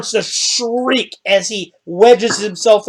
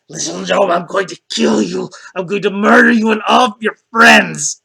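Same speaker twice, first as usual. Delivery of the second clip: cursing orc. Acted. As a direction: threaten them as a huge orc, building to a shout. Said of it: "cursing at you and the orc".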